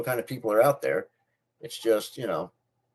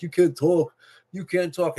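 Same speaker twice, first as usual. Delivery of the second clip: higher, sneering playground taunt; mocking bully; 7 semitones higher than usual. Bullies jeering at him, a mocking higher taunt. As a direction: jeer it like a schoolyard bully.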